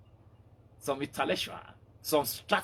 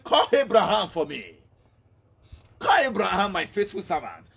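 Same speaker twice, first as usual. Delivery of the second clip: louder summoning call; commanding call; 4 semitones higher, 7 dB louder than usual. Acted. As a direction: call out with authority. God summons Abraham loudly.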